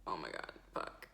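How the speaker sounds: hushed, whispered dismay; mortified whisper. Retold or imitated. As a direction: whisper it to yourself in dread. Mortified inner reaction in silent room.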